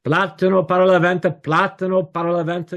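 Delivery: high, loud sing-song hawker's call; imitated Lima vendor calling out in Spanish; street vendor's cry. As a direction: hawk your wares across the street. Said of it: "I would listen to the street vendors".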